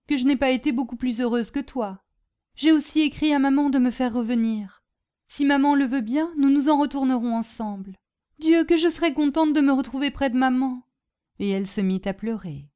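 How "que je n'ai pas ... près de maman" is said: raised, slightly hurried confiding; a touch of girlish distress; homesick young sister. Acted. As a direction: pour out a longing for home.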